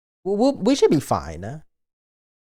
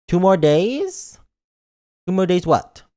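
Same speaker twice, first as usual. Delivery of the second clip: louder slow puzzled; louder slow surprise; surprised; 4 semitones higher, 4 dB louder, slower than usual. Real, not acted. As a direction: drag out the confusion. Surprised question.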